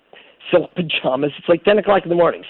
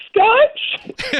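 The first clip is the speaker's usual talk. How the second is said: cracking squeak, much higher; puberty voice crack. Retold or imitated. Nervous young architect answering [name] in a cracking high squeak. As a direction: squeak it out like a boy whose voice is breaking. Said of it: "like I just started puberty".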